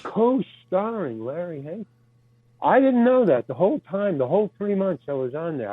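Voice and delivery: normal talking voice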